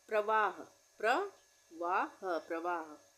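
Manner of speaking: प्रवाह stretched very slowly; slow dictation. Shown as a demonstration. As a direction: draw out प्रवाह syllable by syllable, unhurried. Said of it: "spelling dictation, slowed repeat".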